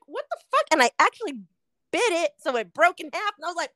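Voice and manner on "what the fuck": high-pitched shocked exclamation. Retold or imitated. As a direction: blurt it in high disbelief. Retelling finding clip in burger.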